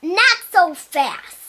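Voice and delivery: hint of a witch, stern and slow. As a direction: play the witch stopping them, slowing down. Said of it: "the witch was like".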